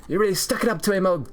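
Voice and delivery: high, lightly voiced British lad cheering; hearty British chum. Schoolmates congratulating him, lightly voiced high British cheer. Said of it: "sounds like a British orphan kid name".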